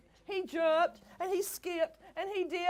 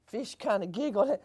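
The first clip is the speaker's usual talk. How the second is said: ordinary delivery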